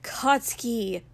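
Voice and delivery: drawn-out, slow name; hissed warning. A narrator's reading. Helper trying to stop his friend's rant, hissing. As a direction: hiss the name as a reproach. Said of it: "Izuku hissed".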